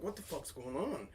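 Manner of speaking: very soft, baffled aside; confused mutter. Retold or imitated. Stranger joins his mission, muttered confusion. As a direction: mutter it under your breath.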